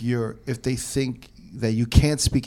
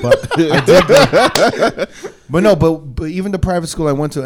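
laughing; 5 semitones higher, 9 dB louder than usual. Real, not acted. Laughs while answering friends.

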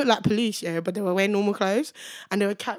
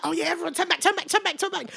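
higher, hurried mock alarm; retold urgent warning; 7 semitones higher, faster than usual. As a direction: repeat it like a covert retreat order.

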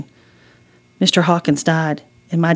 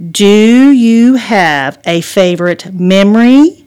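every word of "Do you have a favorite memory?" pressed out slowly and louder; slow, deliberate, louder, each word hit; slow, each word stressed; 4 semitones higher, 7 dB louder, slower than usual. Acted. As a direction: spell it out word by word to a distracted mother. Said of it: "Hannah spoke slowly and stressed each word".

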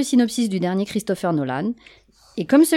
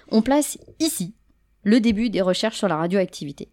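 usual speaking voice

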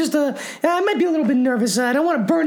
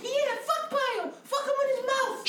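rowdy fan cheering; 8 semitones higher, 9 dB quieter than usual. Acted.

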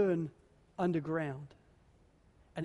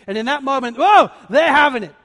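sudden outburst; 4 semitones higher, 14 dB louder than usual. Acted. Bitterness finally erupts.

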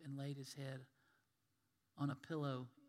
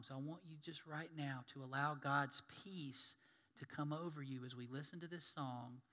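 usual speaking voice

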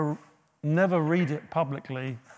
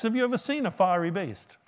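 ordinary talk

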